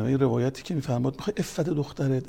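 ordinary delivery

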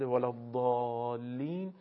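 slow «ولا الضالین» with a long held vowel after ض; elongated madd. Shown as a demonstration. Teacher points out the madd, long stretched aa in «الضالین». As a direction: draw out the aa in «الضالین» far beyond normal. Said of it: "لازم هست که بیش از حالت عادی".

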